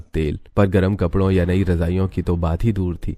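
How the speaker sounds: ordinary delivery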